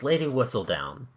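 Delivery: usual speaking voice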